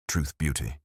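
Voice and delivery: unsure of the words, lightly held back; hesitant. A narrator's reading. Lewis finishing the Keats line, mildly hesitant. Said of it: "he finished hesitantly".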